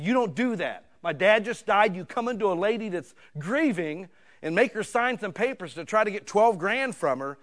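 loud, high furious telling-off; retold angry yell. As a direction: shout him down for cheating a grieving widow. Retold confrontation, jumped off couch.

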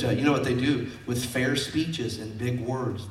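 ordinary talk

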